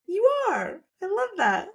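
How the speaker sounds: put-on very high cooing baby talk. Mom cooing back at her toddler, very high. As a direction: coo it up high like talking to a toddler.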